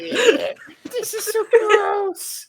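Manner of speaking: very high, slow mock disgust; disgusted drawl. Real, not acted. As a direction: stretch it out grossed out.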